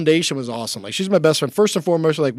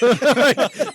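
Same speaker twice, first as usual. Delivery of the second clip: nervous laugh; 9 semitones higher than usual. Real, not acted. Nervous laugh mid-story.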